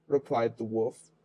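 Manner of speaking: ordinary delivery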